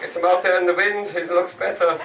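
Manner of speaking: attempted comic Dutch accent. Comic apologising for his best attempt, Dutch accent. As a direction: put on a rough Dutch accent. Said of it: "my best attempt at a Dutch accent".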